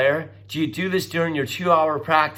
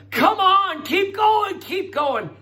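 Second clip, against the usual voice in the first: encouraging shout; 12 semitones higher, slower than usual. Retold or imitated. Coaches urging tired riders on the bike, cheering. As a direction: push them on like a coach at their side. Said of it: "we're encouraging them".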